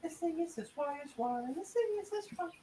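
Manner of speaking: high, soft squeaky imitation; squeaky mock voice. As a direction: parrot it back in a tiny voice. Mocking high squeaky echo.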